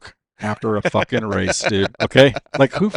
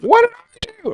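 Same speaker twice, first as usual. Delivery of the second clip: much higher whiny protest; squeaky innocent mom; 7 dB louder than usual. Retold or imitated. Mom playing innocent after being shoved out. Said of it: "when she goes like".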